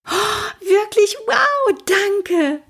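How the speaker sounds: put-on a thrilled girl who can't contain herself; overjoyed. Mathilda, granted the internship, squeals with joy.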